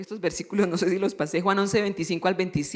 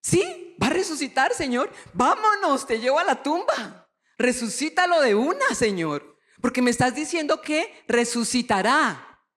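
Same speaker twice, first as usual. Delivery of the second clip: high, eager and urgent; bubbly believer pleading to hurry; eager Marta with faith; 4 semitones higher than usual. Pretend. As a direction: rush Jesus to the tomb with excitement. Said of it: "yo de ser Marta con fe".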